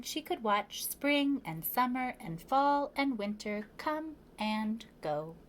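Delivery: ordinary delivery, nothing marked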